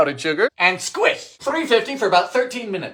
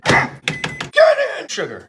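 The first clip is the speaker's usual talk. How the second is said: ordinary talk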